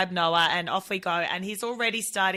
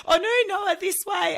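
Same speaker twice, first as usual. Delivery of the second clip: put-on high, louder flustered call to the boy; embarrassed mum calling her son, much higher; 11 semitones higher, 4 dB louder than usual. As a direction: call him back, mortified.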